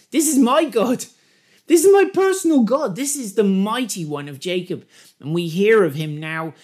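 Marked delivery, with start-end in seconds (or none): 0.1-4.9 high, loud, exultant proclamation; impassioned declaration. Acted. Preacher voicing Jacob claiming his own God, impassioned. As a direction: cry it out with personal conviction.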